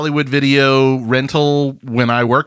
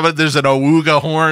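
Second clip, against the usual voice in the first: ordinary talk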